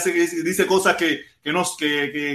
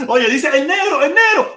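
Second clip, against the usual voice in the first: calling out a friend; 6 semitones higher, 4 dB louder, slower than usual. Retold or imitated. Calling out greeting to viewer.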